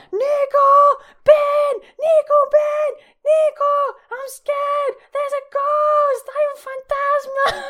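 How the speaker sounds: high, hammed-up frightened yelling; top-of-lungs shouting. Scared woman yells to deaf-to-her boyfriend about ghost, shouting. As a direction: shriek for help at a very high pitch, stretching the words. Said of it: "in the top of my lungs".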